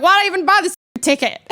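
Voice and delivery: high, fast complaint; mock exasperation. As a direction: complain in comic regret at wasted money. Acted exasperated self-question.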